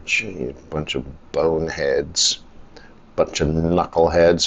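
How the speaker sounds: low, quiet grumble to oneself; grumbling. Real, not acted. Traveler griping about the airline's online form, grumbling.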